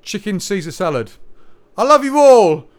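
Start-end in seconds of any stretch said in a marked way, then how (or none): 1.8-2.7 loud, high-pitched sign-off holler; shouted farewell. Pretend. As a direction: belt out a big goodbye, last word held long.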